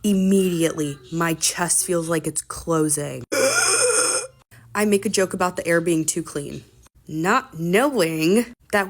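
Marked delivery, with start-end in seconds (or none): 3.3-4.2 high, strained gasp for air; wheezing gasp. Retold or imitated. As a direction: gasp as if the chest is closing. Chest closing at altitude.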